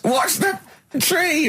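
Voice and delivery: very high, panicky squeal; screeching warning from the buggy, imitated; mock scream. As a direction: squeal it like a terrified passenger. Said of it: "He screams".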